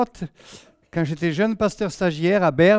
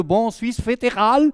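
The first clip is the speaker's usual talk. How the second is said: put-on Swiss German accent; 5 semitones higher, 3 dB louder, slower than usual. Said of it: "ils s'attendaient à un traducteur avec le".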